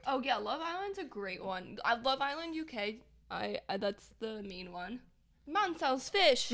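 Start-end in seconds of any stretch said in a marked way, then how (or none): 5.5-6.5 slow mock British geezer line; mock British accent. Mocking British Love Island boys, slow British accent.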